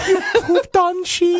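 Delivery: maid voice breaking into laughter; laughing maid accent. Pretend. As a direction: laugh through the accented line.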